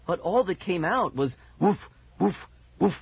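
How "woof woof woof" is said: short, spaced woofs; dog bark. A narrator's reading. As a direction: bark in place of words. Dog barks instead of words.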